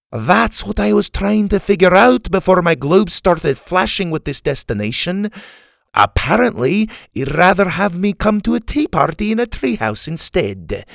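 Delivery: put-on high-pitched, indignant old Scot; crotchety Scotsman, grumbling. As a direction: complain like a put-out Scottish grump. Story's crotchety old Scottish man.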